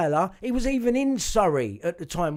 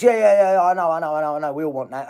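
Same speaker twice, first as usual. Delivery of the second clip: mocking the lawyer; 9 dB louder, faster than usual. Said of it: "how dare you talk to a victim like that".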